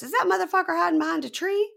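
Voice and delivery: high fast outraged disbelief; incredulous. Retold or imitated. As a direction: shriek it in disbelief. Nicole incredulous at hiding man.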